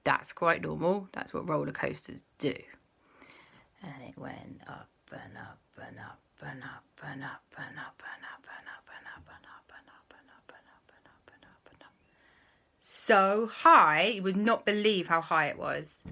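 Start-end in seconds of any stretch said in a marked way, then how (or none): 3.8-11.9 whispered climb, fading away; hushed suspense. A narrator's reading. Storyteller as the roller coaster climbs, fading whisper. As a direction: chant it under the breath, ever fainter.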